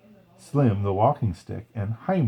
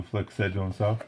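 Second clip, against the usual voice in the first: ordinary delivery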